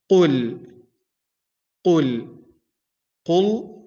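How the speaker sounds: qul with a heavy back q and a light l, for repeating; correct qul. Shown as a demonstration. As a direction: model qul slowly for the student.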